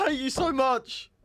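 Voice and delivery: very high, hammed-up cry of hate; mock outraged wail. Podcaster raging at co-host over lost guest, mock screech. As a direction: wail it in pretend despair.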